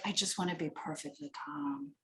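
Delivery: imitating a client who wants calm. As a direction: settle it down to near nothing. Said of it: "this really calm voice".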